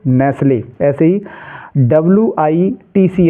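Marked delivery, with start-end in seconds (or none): 0.1-0.6 "नेस्ले" with no t; "नेस्ले", the T dropped; silent T. Shown as a demonstration. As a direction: correct it to "नेस्ले", s gliding into l. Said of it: "नेस्टले नहीं".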